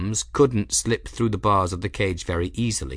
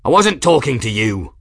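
louder exasperated bellow; 4 semitones higher, 7 dB louder than usual. A narrator's reading. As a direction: yell it back red-faced. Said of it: "the man bellowed".

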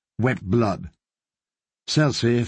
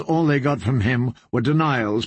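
usual speaking voice

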